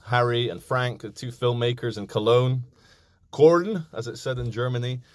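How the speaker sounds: normal talking voice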